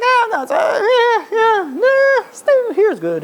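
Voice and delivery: very high-pitched, hammed-up reluctance; reluctant protest. Man begging off going along, hammed-up high reluctant protest.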